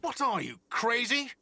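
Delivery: high incredulous retort; indignant. Acted. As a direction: scoff in disbelief. Repeated denial.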